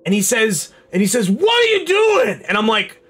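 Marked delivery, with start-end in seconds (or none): 1.3-2.4 put-on older drunk man. Old deli drunk accosting a customer, drawn-out high shout. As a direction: bellow it slowly, pitched way up. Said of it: "a man in his, like, sixties or seventies".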